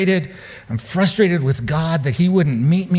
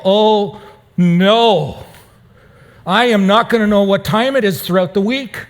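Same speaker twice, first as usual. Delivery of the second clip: higher, louder mock panic at the lost watch; retold dismay; 6 semitones higher, 4 dB louder than usual. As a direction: play up the dismay, oh no!.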